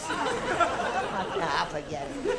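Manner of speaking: high, frantic zany voice; Jerry Lewis bingo caller. Retold or imitated. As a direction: squeal it like a zany comic. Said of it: "I think he'd do it something like this".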